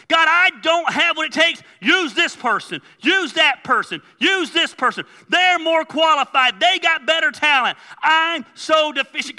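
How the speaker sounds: high, loud, insistent excuses; pleading Moses. Acted. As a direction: beg God to pick someone else, loudly. Moses begging God to use someone else.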